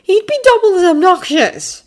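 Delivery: put-on high, loud haughty boy voice; Draco voice. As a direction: promise it with a sneer. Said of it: "Draco promised".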